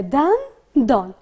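دندان drawn out slowly as two parts with a gap between; split into syllables. Shown as a demonstration. Teacher showing pupils the two parts, دندان split syllable by syllable. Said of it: "کلمه دو بخش داره".

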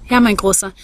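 ordinary delivery

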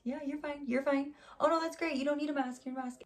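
high, over-nice reassurance; fake friendly. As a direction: imitate someone being sweetly polite to your face. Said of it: "trying to be friendly to you".

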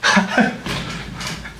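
breathless, voiceless laughter; wheezing laugh. Real, not acted. Storyteller cracking up at his own dad imitation, wheezing laugh.

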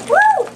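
short high-pitched loud "woo"; excited whoop. Real, not acted. Child cheering as surprises get dumped out, excited whoop.

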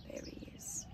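hushed aside pointing at the picture; whispered aside. Real, not acted. Reader to child, pointing out the tiny seed, whispered.

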